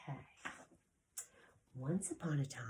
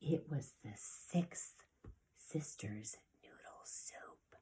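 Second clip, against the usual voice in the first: hushed, slow reveal; suspenseful whisper; 2 semitones lower, 7 dB quieter than usual. Acted. Teacher telling children what the dragon smelled, in a slow whisper. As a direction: whisper it like a secret.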